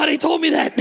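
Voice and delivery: put-on high, flustered young assistant caught out; flustered girl. Late assistant to the photographer, high flustered voice. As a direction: squeak it like a caught-out kid.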